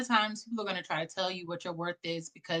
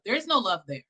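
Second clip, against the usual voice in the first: normal talking voice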